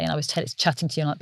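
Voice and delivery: normal talking voice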